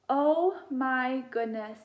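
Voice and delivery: slow, drawn-out loud gasp of surprise; storyteller shocked at the animals in bed. Acted. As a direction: stretch it out in big astonishment. Lights on, animals discovered.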